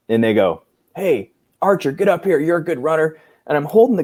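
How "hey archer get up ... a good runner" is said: higher-pitched mock calling out; retold summons. Superiors summon a trainee to hold the guide on, imitated high-pitched summons. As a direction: call the runner up, pitched high.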